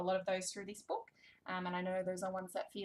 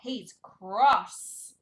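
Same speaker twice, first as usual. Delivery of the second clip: loud, emphatic storybook grumpiness; playful crossness; 12 dB louder than usual. Pretend. Reader describing hiss cat to toddler, loud mock crossness. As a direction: lean hard on how cross he is.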